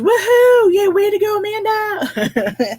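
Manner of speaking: high, loud woohoo that slows at the end; reading a cheer. Retold or imitated. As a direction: belt out the viewer's cheer, stretching the name. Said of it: "And Zoila goes".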